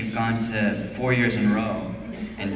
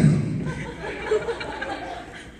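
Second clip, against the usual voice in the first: high laugh. Real, not acted. Laughing at prank story.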